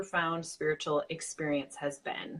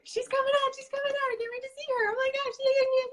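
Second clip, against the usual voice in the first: excited squeal; faster than usual. Real, not acted. Guest about to appear on stream.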